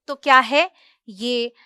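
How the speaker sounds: normal talking voice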